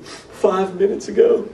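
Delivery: choked-up, high and slow, near tears; a retold tearful whisper of relief; tearful reply. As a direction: say it through tears, barely holding on. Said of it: "with tears running down my face".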